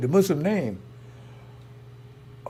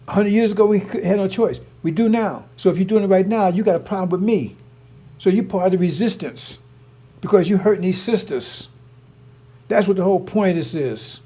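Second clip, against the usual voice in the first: louder and higher, real anger building; heated rant; 5 semitones higher, 6 dB louder than usual. Real, not acted. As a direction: hammer it home, fired up and indignant. Heated rant defending the sisters.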